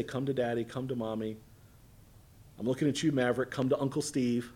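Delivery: put-on coaxing a toddler.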